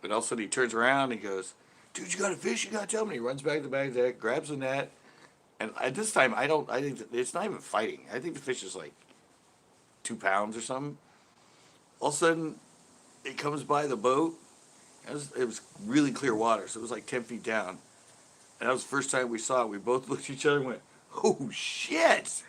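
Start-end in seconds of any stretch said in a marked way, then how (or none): 1.9-3.2 higher-pitched, urgent imitation of the pro; excited retold exclamation. Pro to angler on spotting a fish, mock excited. Retelling the pro's excited reaction. 21.2-22.4 high-pitched stunned exclamation; retold astonishment. Two anglers first seeing the big fish, astonished.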